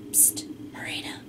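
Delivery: barely audible full whisper. A narrator's reading. Boy getting his friend's attention unseen, whispering. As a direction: hiss it secretly, very soft. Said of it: "Eight whispers".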